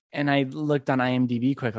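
usual speaking voice

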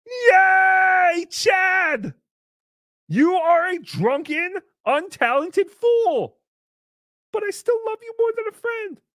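very high, drawn-out squeal; piercing put-on sing-song affection; mock gushing greeting. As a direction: coo it sky-high and slowly, hammed-up. Plays herself greeting Chad on mock show.

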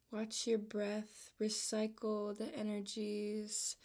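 calm, unhurried voice with long pauses between phrases; measured, even-toned meditation guide; guided meditation. Real, not acted. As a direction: ease the listener into relaxation, leaving room to breathe.

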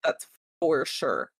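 ordinary talk, nothing marked

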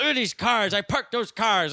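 loud, high-pitched boasting; proud uncle. Retold or imitated. Uncle showing off the cars he parked, loudly proud. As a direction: crow about your own handiwork. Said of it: "he's proud of it".

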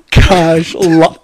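ordinary talk, nothing marked

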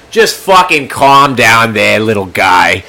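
slow, loud Boston cop drawl; heavy Boston accent, slow and loud. Retold or imitated. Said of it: "full Boston accent".

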